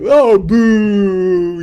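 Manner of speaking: loud, high mock boo; crowd booing. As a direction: boo him like a whole stadium. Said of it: "everybody went".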